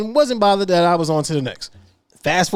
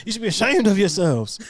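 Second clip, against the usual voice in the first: lightly voiced scolding guard, a bit higher; imitating Hawaiian security guard; 5 semitones higher than usual. Host imagines guard chasing the couple, lightly mock scolding. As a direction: playfully call out a telling-off like a guard. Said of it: "a Hawaiian security guard chasing down".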